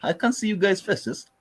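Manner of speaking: strained fake British accent. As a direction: pretend to be English with a bad accent. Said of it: "I speak British English".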